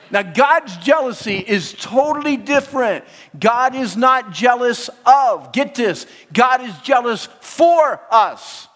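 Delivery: fervent youth-pastor sermon; preaching. Real, not acted. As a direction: drive it home from the pulpit. Preaching at full force.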